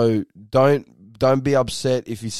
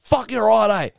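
high-pitched sudden agreement; acted-out realisation, pitched up; imitated conceding; 7 semitones higher, 3 dB louder than usual. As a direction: concede in a raised voice, acting it out. Patron gives in, acted.

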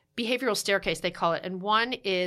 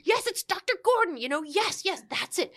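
eager yes; 8 semitones higher than usual. Retold or imitated. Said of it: "And I'm like".

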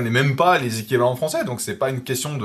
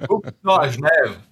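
louder mock Geneva accent; Swiss accent; 3 semitones higher, 5 dB louder than usual. Showing friends he speaks Swiss too, Swiss accent. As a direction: play up a Swiss French accent. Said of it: "je parle suisse aussi".

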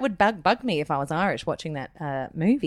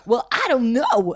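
usual speaking voice

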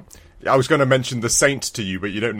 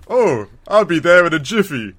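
higher, louder mock hero voice; mock badass agent; 5 semitones higher, 5 dB louder than usual. Agent answers his caller, hammed-up hero voice. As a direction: pipe up as the game's hero, hammed-up. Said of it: "being the manliest, badass agent".

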